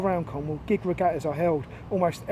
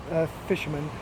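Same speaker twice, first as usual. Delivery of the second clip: plain reading voice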